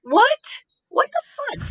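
high squeaky what the fuck; shocked. Real, not acted. Reacting to the sequel in stunned disbelief, shrill squeak. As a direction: shriek it in outrage.

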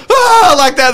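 very high loud shriek; acting out own scream at a bear; mock scream. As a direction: shriek in fright. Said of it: "I would go".

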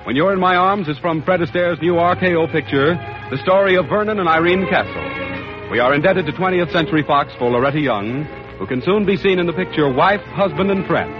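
brisk, bright sponsor announcement; old radio announcer. Acted. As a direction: sell it like a network announcer. Closing sponsor announcement over music.

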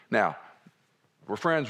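usual speaking voice

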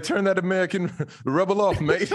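mock a Brit with a wobbly, Australian-sounding accent; bad British accent. Host teasing the London guest, terrible mock British accent.

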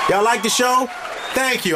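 high, shouted show-host call to the crowd; Keenan Ivory Wayans. Pretend. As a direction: shout thanks over the applause. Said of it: "I feel like I'm Dean-, Keenan Ivory Wayans".

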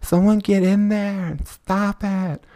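high, slow mock plea of a bystander; drawn-out high mock panic; screaming lady. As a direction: imitate the lady begging someone to stop the fight. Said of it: "that lady in every street fight video, like".

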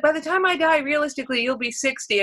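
a hint of a practical mother; lightly voiced mom. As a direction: say it plainly like stating facts. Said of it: "she was like".

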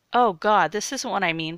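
loud, alarmed protest. A narrator's reading. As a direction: burst out in protest. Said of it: "protested Jared".

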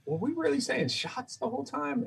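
very high, soft incredulous question; mock disbelief. Looking back years later on the accent, high hushed disbelief. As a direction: squeak it softly as a dawning realisation.

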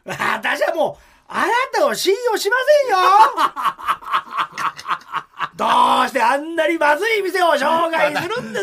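loud, high, accusing; indignant complaint. Retold or imitated. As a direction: scold him loudly, half laughing. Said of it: "また言ったのかな".

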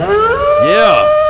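long high howling shout; wolf howl. Acted. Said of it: "Let me get this wolf howl ready for you".

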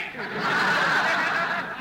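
comic laugh at own gag. Acted. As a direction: laugh at your own joke.

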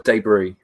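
"debris" said DAY-bree; slow "debris" with a long ay in DAY; British debris. Shown as a demonstration. Host giving a contested example word, DAY-bree. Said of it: "pronouncing words wrong".

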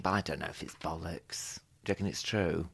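hushed unvoiced whisper. Real, not acted. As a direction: drop to a whisper. Lowers voice, doubting a rumour.

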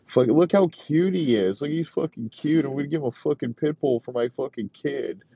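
high, mocking voice of a proud dad gushing over his dog; mock doting dad. Mocking the dad who bought it.

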